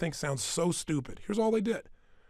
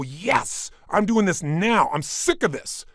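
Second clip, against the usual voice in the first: louder, slow emphatic declaration; determined burst; 3 semitones higher, 5 dB louder, slower than usual. Shown as a demonstration. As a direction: declare it with fired-up resolve. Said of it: "if you go".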